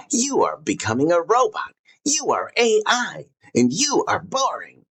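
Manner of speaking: high-pitched accusing caricature; hammed-up high jeer with swooping pitch; mock would-be abuser. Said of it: "a would-be abuser in action".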